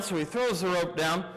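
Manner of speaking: usual speaking voice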